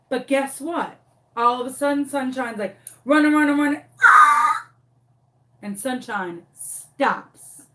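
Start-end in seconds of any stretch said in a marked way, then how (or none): 3.0-3.8 high, loud charging chant; excited dog voice. Retold or imitated. Dog racing toward loose chickens, loud and excited. 4.0-4.7 loud, high wild yell; charging yell. Retold or imitated. Dog charging at loose chickens, yelling wildly.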